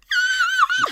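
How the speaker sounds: a very high, loud squeaky shriek; whistle-note squeal. Real, not acted. Embarrassed after whistle-note try.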